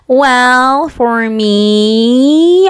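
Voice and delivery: slow, creaky vocal fry. Acted. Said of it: "you probably can't even stand this right now".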